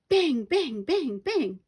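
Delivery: high, loud banging cries; loud gun noises. A narrator's reading. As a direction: shout each bang. Twins banging noisily.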